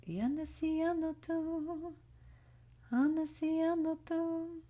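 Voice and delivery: high, slow, sustained chant-like call; canyon echo call. Retold or imitated. Reader imitates canyon echo, high drawn-out call. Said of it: "yells into a canyon and then it comes back".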